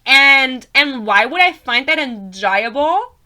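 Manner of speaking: loud, high, drawn-out curious question; Poppy's voice. Acted. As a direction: overplay a high-voiced, inquisitive heroine. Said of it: "I think she talks like that".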